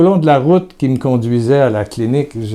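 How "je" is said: usual speaking voice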